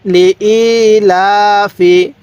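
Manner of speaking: very slow, with a long held final vowel; sustained, level chant-like reading; model Quran recitation. Shown as a demonstration. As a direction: stretch each vowel for learners to follow. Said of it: "আমার সাথে পড়ুন".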